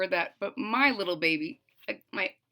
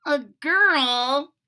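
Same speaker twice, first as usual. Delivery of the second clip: surprised cry; 5 semitones higher, 5 dB louder than usual. A narrator's reading. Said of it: "cried Froggy".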